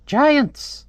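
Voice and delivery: high-pitched bird exclamation. Acted. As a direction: cry it out as an alarmed little bird. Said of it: "And the bird's like".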